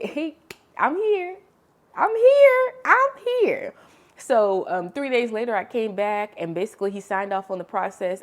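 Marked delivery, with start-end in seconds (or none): 0.0-3.7 much higher and louder, ringing out as God; booming divine call. Retold or imitated. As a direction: call out like a voice from heaven. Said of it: "God said".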